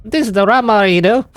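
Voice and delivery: Cockney drunk, hammed-up and high; mock British accent. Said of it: "Is it Scottish?".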